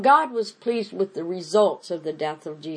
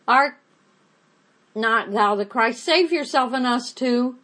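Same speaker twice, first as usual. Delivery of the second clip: railing taunt; 6 semitones higher, 5 dB louder than usual. Acted. Criminal rails at Jesus.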